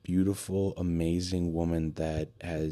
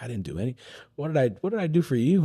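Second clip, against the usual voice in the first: modest friend; 9 semitones higher, 5 dB louder, faster than usual. Retold or imitated. Bonnie brushes off his thanks.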